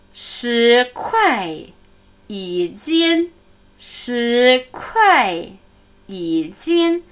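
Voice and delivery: slow, clear Chinese price call; seller's answer. Acted. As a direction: announce shi kuai yi jian like a market seller. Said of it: "Verkäufer antwortet". (wie ein Verkäufer: Shi kuai, yi jian)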